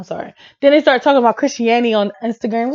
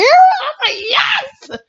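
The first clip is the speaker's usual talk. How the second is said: high, slow laughing shout; excited yes; 12 semitones higher, much slower than usual. Retold or imitated. Fan cheering when [name] was announced, high laughing shout.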